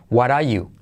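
"What are you" as "wara you", no R in "are"; R dropped. Shown as a demonstration. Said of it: "la R de what are What are decae". («What are you» sin la R de «are»)

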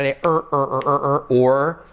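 repeated "er" with a held r; "er, er, or" with a strong r; R sound drill. Shown as a demonstration. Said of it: "letra clave R".

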